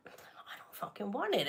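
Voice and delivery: hushed, breathy swear under the breath; whispered curse. Retold or imitated. To customer support, muttered whispered refusal. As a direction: spit it out in a whisper. Retelling reply to support's offer.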